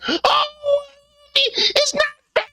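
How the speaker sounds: high, whimpering mock sob; mock crying. Man mocking a woman crying that it's not fair.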